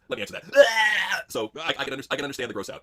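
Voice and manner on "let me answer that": slow shouted reply. Retold or imitated. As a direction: drag it out slow and loud. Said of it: "Mario Cantone just went".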